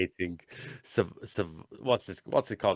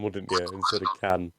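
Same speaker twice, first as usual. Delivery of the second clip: usual speaking voice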